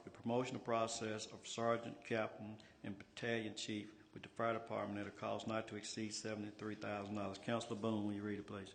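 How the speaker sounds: ordinary talk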